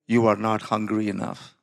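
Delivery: low, measured retold verdict; Holy Spirit's answer. Holy Spirit answering the pastor's question, solemn and low.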